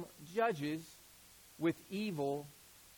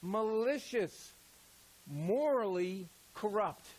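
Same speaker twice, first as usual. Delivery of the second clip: slow emphatic; slower than usual. Shown as a demonstration. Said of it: "the word evil in Greek".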